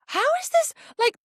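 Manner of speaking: very high-pitched mock disbelief; incredulous outburst. Viewer reacting to the wrong accent, incredulous. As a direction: squeal it in exaggerated bafflement.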